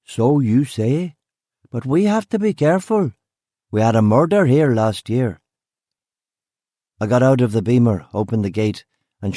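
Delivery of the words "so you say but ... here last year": sceptical country gamekeeper, lilting upward; country accent. Acted. Gamekeeper doubting policeman's word, wary country accent. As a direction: lilt it in a put-on rural accent. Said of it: "he said in a country accent".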